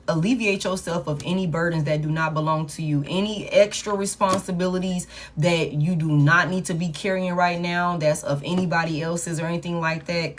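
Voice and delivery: usual speaking voice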